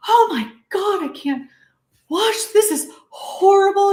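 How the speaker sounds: high-pitched imitation of a horrified mom; freaking-out mother. Mom watching her child on the monitor, freaking out. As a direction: gasp it out as a panicking mom, voice shooting up. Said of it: "she's freaking out".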